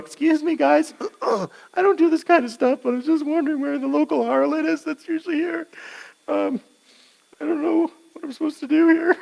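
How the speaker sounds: high, sheepish mock asking; awkward high voice. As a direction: play it awkward and embarrassed. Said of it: "stand there awkwardly going".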